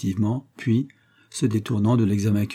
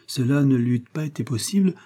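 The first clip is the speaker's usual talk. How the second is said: plain reading voice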